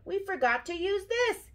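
high-pitched urgent announcement; crying out. A narrator's reading. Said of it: "he cried".

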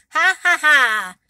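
spelled-out taunting laugh; mock laugh. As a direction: jeer like a bully. Mocking laugh of humiliators.

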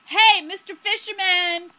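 loud, high-pitched hail; acted, carrying greeting; calling out. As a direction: shout up to someone on a boat. Character hails the fisherman.

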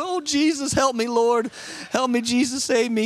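high-pitched pleading, hammed-up panic; mock panicked prayer. Pastor re-enacting his prayer in the stalling plane, mock panic. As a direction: beg for rescue in mock terror. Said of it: "I'm freaking out".

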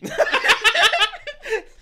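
loud, high cackling laugh; burst of laughter. Real, not acted. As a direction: crack up helplessly. Reader cracks up at the line.

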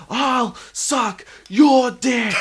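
put-on high, loud, slow drunken shout; drunk yelling. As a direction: bellow it like a hammered bloke. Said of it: "And the guy's like".

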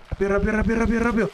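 building, rising urgent rápido; urgent rush. Acted.

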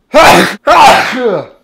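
loud high laugh; burst of laughter. Real, not acted. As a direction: crack up loudly. Laughing after cough drop bit.

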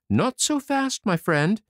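high, teasing calm; cheeky coaxing tailor. A narrator's reading. Tailor mocking the charging unicorn, high and coaxing. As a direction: hold the beast off with a grin.

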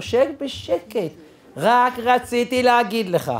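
slow, drawn-out command; deliberate, measured retort; firm silencing. Retold or imitated. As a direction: stretch the words to shut him up. Firmly cutting off the angry father.

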